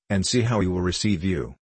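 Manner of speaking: plain reading voice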